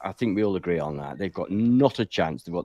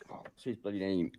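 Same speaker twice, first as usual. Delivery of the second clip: near-whispered grumble; muttering to self; 13 dB quieter than usual. Real, not acted. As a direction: mutter it under your breath while searching your memory. Struggling to recall a name aloud.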